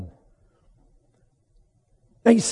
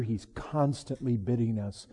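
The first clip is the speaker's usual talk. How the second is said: ordinary talk, nothing marked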